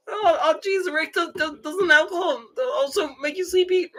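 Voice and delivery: high, stammering cartoon kid voice; Morty. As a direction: fret and stutter in a high voice. Plays Morty answering Rick.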